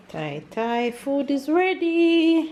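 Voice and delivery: calling out loud and slow; yelling across the room. Real, not acted. Calling child to come eat.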